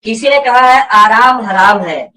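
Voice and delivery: ordinary talk, nothing marked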